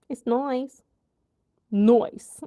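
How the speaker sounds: put-on playful British accent, "nice" said like "noice"; mock British accent.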